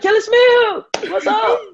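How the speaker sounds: imitating people hailing her by full name; cheery greeting. People spotting her on the street, imitated with a cheery greeting. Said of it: "they're like".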